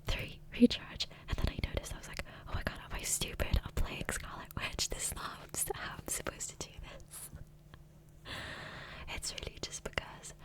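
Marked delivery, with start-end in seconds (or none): none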